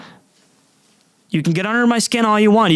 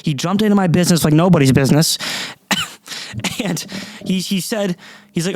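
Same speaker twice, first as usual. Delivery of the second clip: normal talking voice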